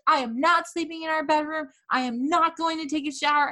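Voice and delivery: put-on yelling at her laughing boyfriend, outraged; mock outrage. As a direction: rail at him, scandalised. Furious rant at boyfriend.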